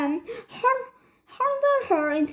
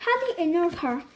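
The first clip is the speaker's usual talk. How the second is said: ordinary delivery, nothing marked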